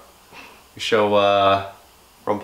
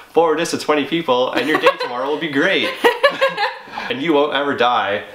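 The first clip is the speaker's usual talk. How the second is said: giggling through a mock chain email; laughing; 5 semitones higher than usual. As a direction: read it while cracking up. Reading chain email while laughing.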